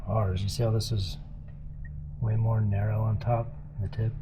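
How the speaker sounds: ordinary talk, nothing marked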